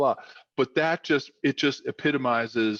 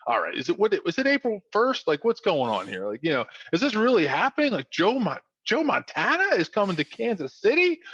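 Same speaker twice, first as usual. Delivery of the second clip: higher-pitched, acted disbelief; incredulous; 7 semitones higher than usual. Teammates seeing breaking trade news, acted disbelief. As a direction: question it as if it must be a prank.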